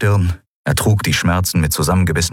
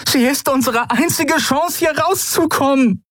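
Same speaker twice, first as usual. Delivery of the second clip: much higher, pleading urgency; desperate plea. A narrator's reading. Endriel begging Keru to spare their only chance, high desperate plea. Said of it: "rief sie verzweifelt".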